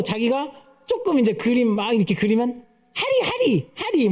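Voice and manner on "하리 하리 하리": put-on high, sing-song toddler calling her own name. A pastor mimicking his toddler boasting of herself, in a high toddler voice. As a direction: chirp it like a proud little child. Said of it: "막 이래요".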